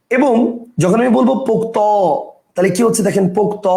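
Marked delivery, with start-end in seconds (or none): 1.5-1.8 পোক্ত said pok-to, with final o; পোক্ত opened out into two syllables; added final vowel. Shown as a demonstration. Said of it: "লাস্টে একটা অ আসতেছে". 3.4-3.7 পোক্ত said pok-to, with final o; পোক্ত in two syllables, open ending; added final vowel. Shown as a demonstration. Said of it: "লাস্টে একটা অ আসতেছে".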